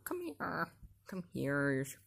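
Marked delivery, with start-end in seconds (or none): 0.0-0.7 high, soft sing-song call to a cat; calling kitty, higher and softer; coaxing pet call. Real, not acted. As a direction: coax the cat over in a raised, gentle voice.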